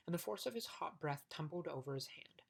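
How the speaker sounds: ordinary delivery, nothing marked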